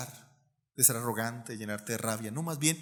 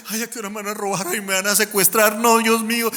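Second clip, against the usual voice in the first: hammed-up fright, louder and higher, quick at first; mock panicky friend; 6 semitones higher, 8 dB louder than usual. Pastor retells his friend's fear of kidnapping, hammed-up panic. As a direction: imitate a scared shopper begging God for safety. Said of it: "Y estaba todo asustado".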